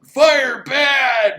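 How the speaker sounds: loud, high grunting caveman voice; caveman zombie. Retold or imitated. Reviewer voicing a zombie afraid of fire, loud caveman grunt. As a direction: bark it like a dim monster. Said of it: "some of the zombies are like".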